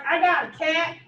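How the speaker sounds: dopey rural pet owner, lightly voiced; stupid owner voice. Vet tech mocking a clueless pet owner, light dopey voice. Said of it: "this voice for my random stupid owner".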